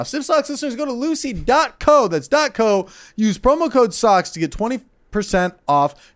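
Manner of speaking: high, loud excited ad read; hyped ad read. Acted. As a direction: sell it with big hype. Hypes ad read.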